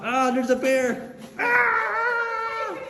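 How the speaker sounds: mock terrified scream; fake fright. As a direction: pretend scared shriek about a bear. Fake bear scare, then laughs.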